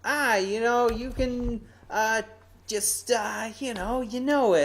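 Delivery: high sing-song parody of a dismissive advisor; mocking advisor voice. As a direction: mimic the advisors brushing it off lightly. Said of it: "So they're like".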